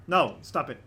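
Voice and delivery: usual speaking voice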